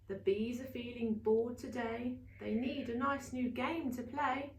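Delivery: plain reading voice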